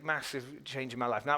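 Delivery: ordinary delivery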